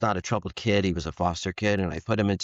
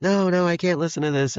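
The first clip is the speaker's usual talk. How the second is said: high, loud mock protest from a teen son; 10 semitones higher, 4 dB louder than usual. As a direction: whine it like a teenager refusing his dad's music. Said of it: "he'd be like".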